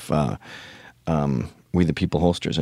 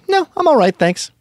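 much higher, breezy mock-polite no thanks; polite refusal; 4 dB louder than usual. Will turning down the marketing lady, high mock-polite. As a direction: brush her off lightly in a high put-on voice. Will declines stadium promo.

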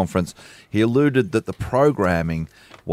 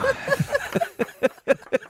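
high laugh; 4 dB quieter than usual. Real, not acted. Laughs after accent bit.

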